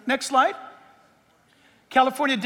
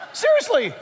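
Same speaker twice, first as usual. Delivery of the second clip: breathy laugh then a higher, laughing seriously; laughing disbelief; 12 semitones higher than usual. Real, not acted. Laughing at a political quote.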